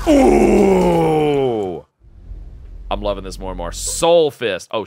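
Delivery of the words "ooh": long falling "ooooh"; drawn-out ooh sliding steadily down; impressed long ooh. Real, not acted. Viewer impressed by the move, long falling ooh. Impressed reaction to game footage.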